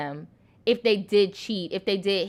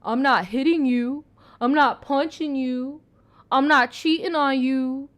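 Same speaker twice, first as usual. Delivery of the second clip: lightly mimicked abuser, voice a bit higher; emotional abuser's catchphrase; 4 semitones higher, 3 dB louder than usual. Vlogger quoting what emotional abusers always say, lightly mocking imitation. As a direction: recite it as a mocking, slightly raised imitation. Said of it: "what's the catchphrase emotional abusers use?".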